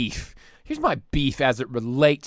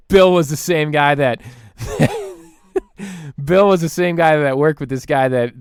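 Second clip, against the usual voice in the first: ordinary delivery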